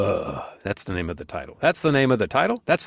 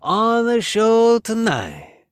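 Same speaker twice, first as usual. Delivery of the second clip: hammed-up Italian-American host voice, higher and louder; Italian-American accent; 6 semitones higher, 4 dB louder, much slower than usual. Host introducing guests in a hammed Italian-American accent. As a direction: sell the guests like a New York Italian announcer. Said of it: "I always go back to that accent".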